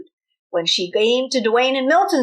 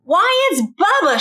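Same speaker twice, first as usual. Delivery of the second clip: higher, louder call-out; mock shout; 8 semitones higher, 3 dB louder than usual. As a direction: point him out to the room. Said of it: "shouted Dwayne".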